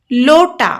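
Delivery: लोटा said as lo-ta with a clear long o; word lota. Shown as a demonstration. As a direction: model लोटा slowly for the class. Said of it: "तो पहला शब्द है". (लोटा का उच्चारण साफ़ करके दिखाएँ)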